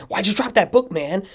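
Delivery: high, panicked why'd you drop that book; paranoid kid. Retold or imitated. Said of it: "paranoid and like".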